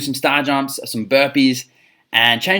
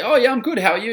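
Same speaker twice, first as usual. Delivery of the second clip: much higher, chirpy acted reply; friendly lead replying; 10 semitones higher than usual. Coach acting out a lead on a sales call, lightly chirpy reply. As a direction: play the lead answering the small talk. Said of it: "they'll say".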